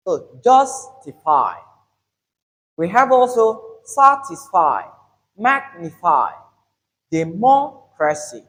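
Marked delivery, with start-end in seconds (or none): 0.4-1.5 "justify" slow, JUS drawn out; third-from-end stress. Shown as a demonstration. Teacher models stress counted from the end, JUS stressed. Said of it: "we have the stress on the third". 3.8-8.4 punched SA in "satisfy", MAG in "magnify", MO in "democracy"; third-from-end stress. Shown as a demonstration. Teacher lists example words, stressed syllables punched. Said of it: "we have the stress on the third".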